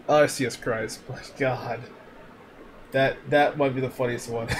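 ordinary talk, nothing marked

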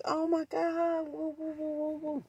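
high, hushed mock gushing; imitated delight. Cousin admiring the new car, high soft mock delight. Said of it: "She was happy".

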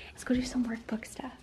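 soft whisper; quietly whispered aside. Real, not acted. Still near sleeping baby.

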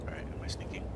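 hushed sneaky whisper. Real, not acted. Sneaking in game.

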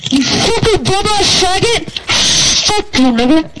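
high furious gamer rage; screaming at the enemy; angry yelling. Real, not acted. Raging at looting enemy.

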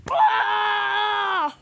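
loud, drawn-out yell; long acted scream. Leonard under attack, screaming long and loud. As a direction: hold one long loud cry.

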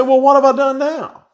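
what did I do this time; David answering his angry big brother, high and exasperated; exasperated little brother. Retold or imitated. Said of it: "And David said".

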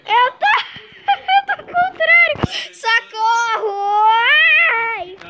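high laughing shrieks; screaming while falling. Real, not acted. Upside down, laughing and yelling.

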